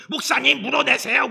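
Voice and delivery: loud, high, indignant demand for compensation; angry demand. Retold or imitated. Mother demanding the pastor pay her back, shouting. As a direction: blame the pastor at full volume. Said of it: "그래, 나한테".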